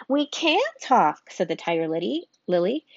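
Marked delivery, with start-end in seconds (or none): 0.1-1.3 higher, slow and louder proud retort; proud flower. Acted. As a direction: draw it out haughtily, a lofty flower. Tiger Lily's retort.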